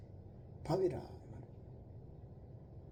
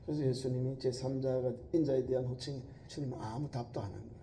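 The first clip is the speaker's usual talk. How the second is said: ordinary talk, nothing marked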